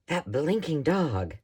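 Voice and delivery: slow, mock British "That blinking dog"; a lightly done British accent, slow and exasperated. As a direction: imitate an English lady scolding her dog in a British accent. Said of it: "in a British accent".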